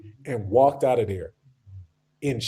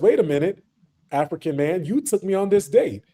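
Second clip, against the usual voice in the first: mock indignant woman, higher, landing hard on the last demand; indignant retort; 4 semitones higher than usual. American woman refuses the bill on her date, indignant. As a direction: act out an offended date's protest. Acted quarrel over a restaurant bill.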